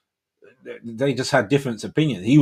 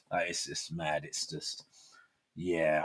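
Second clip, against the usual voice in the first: ordinary talk, nothing marked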